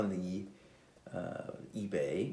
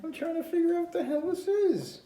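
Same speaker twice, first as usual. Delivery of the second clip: much higher, louder incredulous outrage; exasperated outrage; 7 dB louder than usual. Real, not acted. Ranting to viewers about the toy camera, outraged. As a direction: let the disbelief burst out at the fake camera. Indignant rant.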